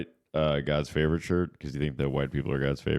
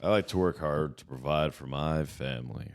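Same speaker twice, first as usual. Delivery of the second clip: usual speaking voice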